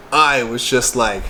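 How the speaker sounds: ordinary talk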